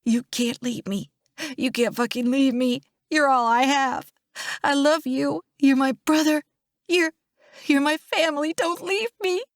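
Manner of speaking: building from tearful to high and distraught; desperate pleading. A narrator's reading. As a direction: beg a loved one to stay, rising in pitch. Pleading with dying brother, building.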